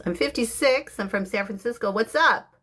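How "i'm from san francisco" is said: ordinary talk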